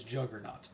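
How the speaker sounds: "Juggernaut" with an English J as in jug; "Juggernaut" with the J fully sounded, not a Y; anglicized pronunciation. Shown as a demonstration. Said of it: "anglicized, yes, it is".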